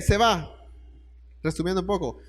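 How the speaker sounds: ordinary talk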